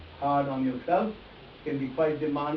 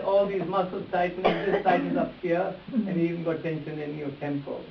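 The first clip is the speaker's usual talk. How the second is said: normal talking voice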